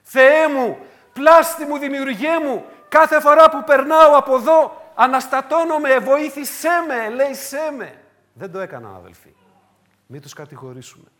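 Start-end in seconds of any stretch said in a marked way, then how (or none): 0.0-8.0 loud, high pleading cry; crying out to God. Pretend. Eve crying out to God at the tree, loud and pleading. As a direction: call out to your Maker as if desperate. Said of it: "Να φωνάξει".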